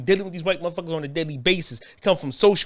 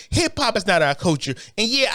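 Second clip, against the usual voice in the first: ordinary talk, nothing marked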